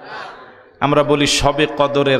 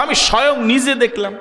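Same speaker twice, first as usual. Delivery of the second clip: mock boast; 9 semitones higher than usual. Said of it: "কয়".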